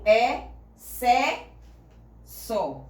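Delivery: excesso broken into é... sé... só, each syllable on its own; spaced syllables, rising on é and sé, dropping on só; sounded-out syllables. Shown as a demonstration. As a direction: sound out "é, sé, só" slowly with clear gaps. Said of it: "Eu escuto".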